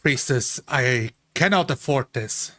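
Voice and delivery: normal talking voice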